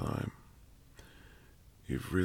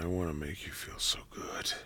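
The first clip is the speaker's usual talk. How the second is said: whispering; 6 dB quieter than usual. Acted. Whispered intimacy.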